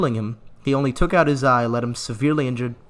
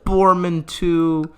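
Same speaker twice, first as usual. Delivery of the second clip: slow, louder hammed-up lament; mock grief; 5 semitones higher, 6 dB louder, much slower than usual. Gamer to viewers, hammed-up mourning for a dead knight. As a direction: drag the name out in fake sorrow. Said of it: "I'm gonna keep doing my bit".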